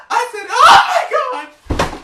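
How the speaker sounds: high, loud, drawn-out shriek of disbelief; ecstatic scream. Retold or imitated. Fan shrieking on the phone to his idol's daughter, overjoyed scream. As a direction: scream it like you can't believe who's on the line.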